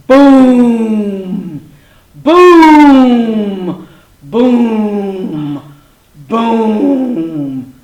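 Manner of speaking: loud, long, falling booms; booming footsteps. A narrator's reading. Little dinosaur hears footsteps through trees, heavy resounding booms. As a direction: boom out each heavy step of a huge creature. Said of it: "he heard through the trees".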